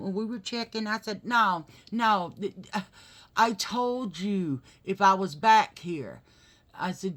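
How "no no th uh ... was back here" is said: loud, higher, sputtering objection; slow, emphatic re-enacted reproach; retold angry protest. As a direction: relive telling the staff off. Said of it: "I was freaking out and pissed off".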